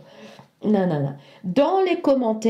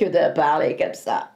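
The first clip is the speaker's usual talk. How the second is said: low, hollow voice from beyond the grave; 6 semitones lower than usual. Shown as a demonstration. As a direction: speak like a ghost from the tomb. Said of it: "je vais parler avec une voix d'outre-tombe".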